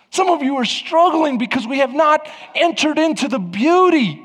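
preaching at full force. Real, not acted. Pastor pressing the congregation toward baptism, impassioned preaching. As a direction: build and drive it like a revival preacher.

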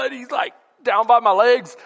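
normal talking voice

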